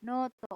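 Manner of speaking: Noto with a drawn-out first o; long o vowel. Shown as a demonstration. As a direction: stretch the o in Noto. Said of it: "O-nya double dibaca panjang".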